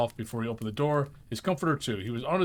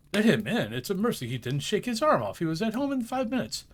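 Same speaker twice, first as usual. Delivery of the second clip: usual speaking voice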